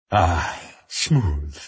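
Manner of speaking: lightly gravelled, satisfied sigh after a swig; gravelly post-drink savouring. A narrator's reading. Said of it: "he said in a gravelly voice".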